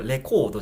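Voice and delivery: "レコード" as flat Japanese syllables, long コー; wrong katakana reading. Shown as a demonstration. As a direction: Japanese loanword "レコード" with each mora sounded. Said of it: "レコードじゃない".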